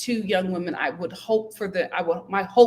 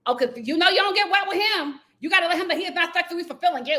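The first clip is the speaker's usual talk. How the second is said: high mocking voice; mock sister; 8 semitones higher, faster than usual. Mocking the sister's advice to Raya, high voiced. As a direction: parody her advice. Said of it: "mocking sister's advice".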